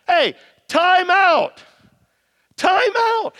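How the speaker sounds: loud, high, slow "time out"; loud interruption. Real, not acted. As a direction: stop them short with a loud call. Preacher stops the congregation.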